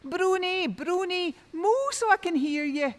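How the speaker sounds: high, loud calling; shouting. A narrator's reading. Tom calls out for the cow, shouting high. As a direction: call out for the cow again. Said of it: "he shouts out".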